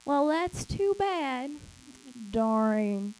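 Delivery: slow, shrugging, half-hearted sympathy; mock careless shrug of a voice; dismissive pity. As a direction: brush it off like it's not your problem. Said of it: "He didn't look at us in pity and say".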